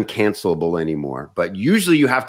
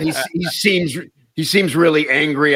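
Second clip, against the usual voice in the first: worried; 8 semitones higher, 3 dB louder than usual. Retold or imitated. Nervous remark to manager.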